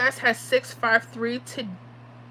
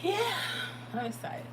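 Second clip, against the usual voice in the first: much quieter, dry and flat claim of excitement; deadpan "I'm excited"; 8 semitones higher, 12 dB quieter than usual. Real, not acted. Streamer answering viewers who ask if she's excited, deadpan. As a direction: undersell it, almost under the breath. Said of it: "Aren't you excited?".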